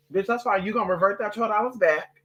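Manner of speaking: normal talking voice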